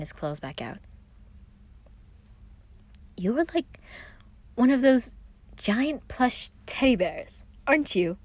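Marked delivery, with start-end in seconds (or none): 3.2-8.2 stunned blurt. A narrator's reading. Roman dazed after being lifted, higher bewildered blurt. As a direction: blurt it out still in shock. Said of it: "the only thing Roman could think to say".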